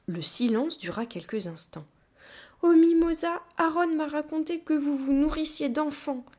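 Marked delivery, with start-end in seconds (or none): 2.6-6.3 put-on high childlike whine; tearful confession. A narrator's reading. Child admits the rumour through spread fingers, tearful confession. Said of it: "avouai-je".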